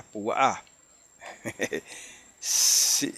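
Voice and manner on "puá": puÁ, with the weight on the last A; stress on final A. Shown as a demonstration. Teacher correcting the Hebrew name, Púa stressed on final A. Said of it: "el acento es en la A".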